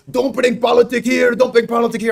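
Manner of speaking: high, loud mocking yell at the speaker; imitating angry fans telling him off at the game. Fans yelling at the speaker in the stands, mock angry high shout. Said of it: "They get really angry".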